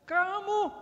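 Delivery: sudden high shout; marah meledak. Acted. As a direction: explode at them in one loud word. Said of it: "Kalau kita sudah marah meledak". (bentakan keras melengking)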